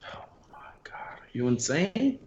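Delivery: stunned, breathless whisper; shocked whisper. Real, not acted. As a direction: gasp it out in disbelief. Shocked at friend's chicken ranking.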